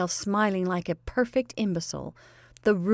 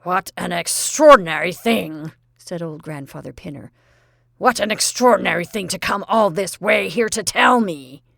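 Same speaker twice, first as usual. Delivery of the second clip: put-on loud, gruff old man's wonder; bemused old colonel; 8 dB louder than usual. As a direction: muse aloud like a puzzled old colonel. Grandfather bemused by message.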